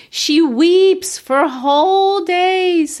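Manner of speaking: slow, high, drawn-out lament; mock tragic. Teacher mocks the heroine's weeping with a slow, high lament. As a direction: stretch it out like a melodrama.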